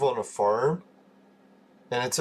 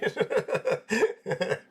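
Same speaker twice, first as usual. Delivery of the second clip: high laugh; 9 semitones higher than usual. Real, not acted. Streamer laughing to viewers about belching on stream, high giggle.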